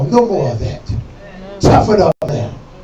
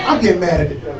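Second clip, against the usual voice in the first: usual speaking voice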